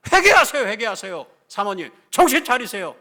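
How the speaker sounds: explosive high shout, a stern call to repent; shouted rebuke. Acted. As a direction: command at full volume like a scolding preacher. 참았던 질책의 절정을 연기함. (버럭 소리치며 꾸짖듯)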